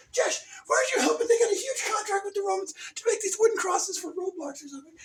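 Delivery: high, fast nagging voice; hammed-up scolding of a lazy son; nagging parent. Parent scolding Jesh to help with crosses, high nag. Voicing Jesus's mother/family nagging.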